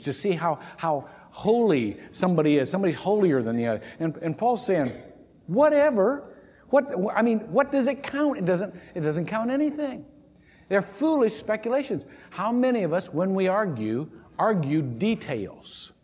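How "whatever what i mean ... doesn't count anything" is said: high, scoffing brush-off; dismissive whatever. Retold or imitated. Paul brushing off lineage quarrels, high and scoffing. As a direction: wave it away like it's worthless. Preacher voicing Paul's dismissive retort.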